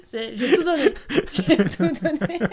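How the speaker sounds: high, loud, breaking into laughter; helpless laughter over your own bad performance; laughing hard. Real, not acted. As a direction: say it through real giggles. Cracking up over tree act.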